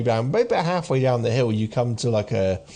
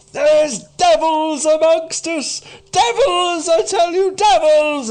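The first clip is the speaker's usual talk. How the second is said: very high, loud, drawn-out shout; ranting doomsayer; 8 dB louder, slower than usual. Acted. Wrinkled, dishevelled man warns a scornful audience, ranting shout. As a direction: cry out a frantic warning to a crowd. Ignored street ranter warns crowd.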